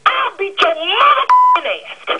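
high, slow, cracking rage; pushed over the edge, threatening the caller; raging threat. Real, not acted. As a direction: scream the threat with voice breaking.

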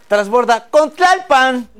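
high, loud, slow announcement; mock metro announcer. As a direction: call the transfer out over the platform.

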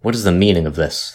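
ordinary delivery

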